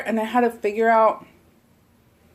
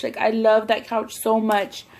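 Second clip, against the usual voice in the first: ordinary talk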